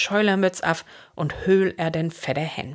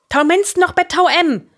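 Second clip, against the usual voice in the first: high, loud firm order; insistent; 12 semitones higher, 6 dB louder than usual. A narrator's reading. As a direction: insist on it, loudly. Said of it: "de Fritz noch eis bestimm". (luud un bestimmt)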